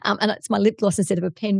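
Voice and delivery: ordinary talk, nothing marked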